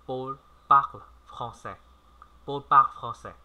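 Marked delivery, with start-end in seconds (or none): 0.1-1.8 slow repeat. Shown as a demonstration. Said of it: "Chúng ta cùng nói lại nào".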